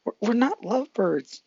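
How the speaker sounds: high, stammering embarrassed protest; flustered denial. Acted. Deku flustered by Mia's teasing, high stammering denial. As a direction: blush and deny it, voice jumping up. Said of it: "Deku just blushed".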